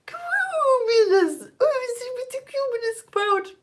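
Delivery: high, drawn-out swooping voice; silly cloud voice. Shown as a demonstration. Teacher acting out a cumulus cloud, silly high slow voice. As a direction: stretch it out high, sliding down at the end.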